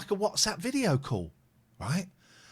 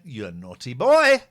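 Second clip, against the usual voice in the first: louder, playful telling-off; mock scolding; 4 semitones lower, 8 dB louder than usual. Driver teases the van man, mock scolding. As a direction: tease him like a naughty child. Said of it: "I went".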